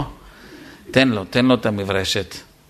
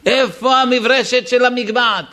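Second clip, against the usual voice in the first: higher, urgent demand; mild retold yell; 11 semitones higher, 3 dB louder than usual. Guest calling out for the hat brush, higher and urgent. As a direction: call out for the hat brush, stressed. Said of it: "יצא לי צעקה".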